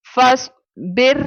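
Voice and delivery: बिर with a thin, light r; slow Quranic बिर with a thin r. Shown as a demonstration. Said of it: "मैं रॉ को बारीक पढ़ रही हूँ".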